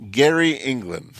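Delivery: put-on slow, heavy Indian accent; thick Indian accent. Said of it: "super thick Indian accent".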